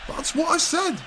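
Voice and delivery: high indignant protest. Acted. Defensive reply to correction.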